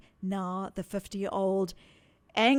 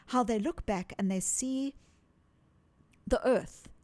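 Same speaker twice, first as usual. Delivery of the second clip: ordinary talk, nothing marked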